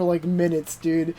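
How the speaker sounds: ordinary talk, nothing marked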